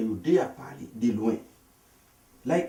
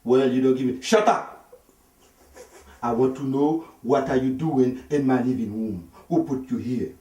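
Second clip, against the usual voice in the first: loud, slow, insistent questioning; angry demand; 4 semitones higher, 5 dB louder than usual. Retold or imitated. Husband demanding why a stranger sits in his living room, loud and slow. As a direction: hammer the question home.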